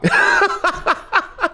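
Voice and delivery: sudden high-pitched laugh; high burst of laughter. Real, not acted. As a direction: break into a squealing laugh. Laughs at own story.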